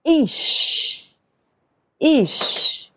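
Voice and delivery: Ish, voiceless high start, drawn out; Hebrew Ish. Shown as a demonstration. Teacher sounding Ish for students, whispered start. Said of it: "Então, fica".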